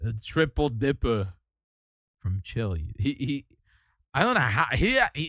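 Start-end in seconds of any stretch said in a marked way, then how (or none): 0.0-2.9 low mimicry, sinking at the end; YouTuber imitation. Streamer echoing the reviewer's food line, low imitation. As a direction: drop the voice and pause before the last words. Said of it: "his voice is so specific".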